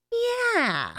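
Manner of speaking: very high drawn-out impression, falling away; Owen Wilson. Guest showing host the actor impression, high and falling. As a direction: do a celebrity's high drawled catchphrase. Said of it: "it sounded like Owen Wilson".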